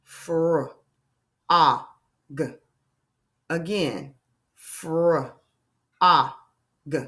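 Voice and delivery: segment Fr-, a, g; sounding out. Shown as a demonstration. Teacher tapping out frog. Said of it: "Let's tap out frog".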